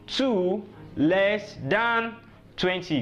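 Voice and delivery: very drawn-out, word by word; slow teaching gloss. Shown as a demonstration. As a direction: stretch each word for the learners. Teacher slowly glosses number's meaning.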